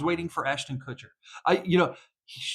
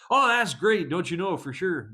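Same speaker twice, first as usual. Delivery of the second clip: put-on Canadian accent; 3 semitones higher than usual. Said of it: "they really do talk that way".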